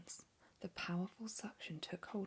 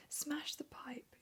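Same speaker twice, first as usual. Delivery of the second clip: higher, slow whispered command; whispered yell; 9 semitones higher, much slower than usual. Acted. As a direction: bark the order, hushed. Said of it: "yelled Missus Gloop".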